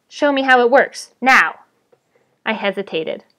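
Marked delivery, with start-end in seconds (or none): 0.1-1.6 raised, louder goblin demand; insistent villain's command. A narrator's reading. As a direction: order it done at once.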